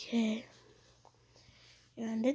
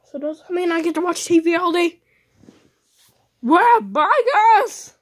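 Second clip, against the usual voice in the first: put-on high, fast and loud kid voice; high excited voice; 8 semitones higher, 10 dB louder, faster than usual.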